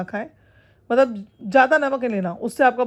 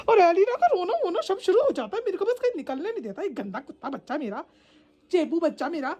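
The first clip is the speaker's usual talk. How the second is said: put-on baby talk; 6 semitones higher than usual. Complaining fondly about the crying dog.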